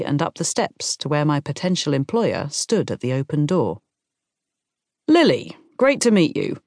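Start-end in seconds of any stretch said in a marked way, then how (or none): 5.1-6.6 high, lifted, hearty hello; effusive greeting. A narrator's reading. Employer welcomes job applicant at the door, effusive. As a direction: greet her brightly, louder on the name.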